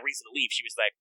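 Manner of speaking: normal talking voice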